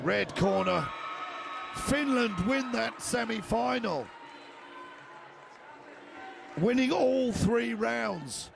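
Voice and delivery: energetic live fight call; play-by-play commentary. Real, not acted.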